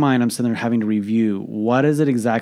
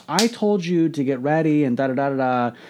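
imitating a frustrated wife, higher pitched; mock-scold in a raised pitch; impatient scolding; 4 semitones higher than usual. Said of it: "her frustration and her impatience".

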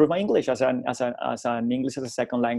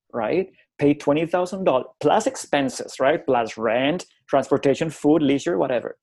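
usual speaking voice

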